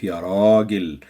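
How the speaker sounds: Ragil said with a long drawn "a" in the first syllable; long alif. Shown as a demonstration. Teacher to learners, Ya Ragil with a long alif. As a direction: stretch the alif in Ragil, Raaagil. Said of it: "love to say it with this long alif".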